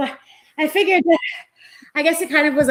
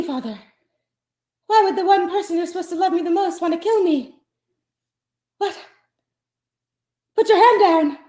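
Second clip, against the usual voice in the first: hammed-up pleading; 9 semitones higher than usual. Pleading with father holding gun.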